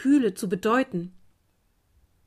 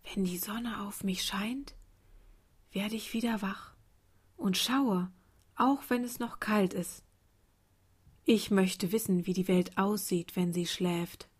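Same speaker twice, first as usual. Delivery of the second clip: sleepy whisper easing into soft voice; hushed bedtime murmur of a small child; 6 dB quieter than usual. A narrator's reading. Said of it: "Vor dem Schlafen flüsterte es".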